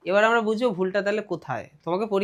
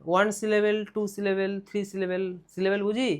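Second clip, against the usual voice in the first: ordinary talk, nothing marked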